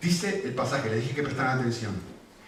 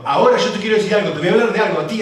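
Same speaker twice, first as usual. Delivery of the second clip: louder, higher and fast, a bold challenge; bold defiant declaration; 7 semitones higher, 8 dB louder, much faster than usual. Retold or imitated. Apostles boldly telling their jailers to their faces, loud and fast. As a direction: fire it straight at them, quick and loud.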